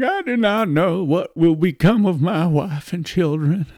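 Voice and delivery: high-pitched, wavering plea of a condemned man; mock blubbering. Condemned man on the gallows lamenting his wife and children, mock blubbering. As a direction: blubber it out, hammed-up. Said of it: "one of them's all blubbering".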